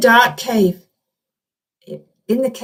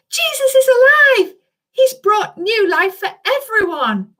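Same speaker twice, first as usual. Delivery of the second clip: excited announcement; 10 semitones higher than usual. Acted. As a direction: announce it with joy. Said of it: "Alfie announced".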